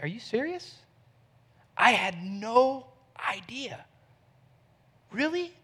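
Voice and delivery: hushed, incredulous reply, slowing on "no idea"; soft, astonished, nearly whispering at the end; stunned disbelief. Retold or imitated. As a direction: act out a friend taken aback. Friend's stunned response, acted out.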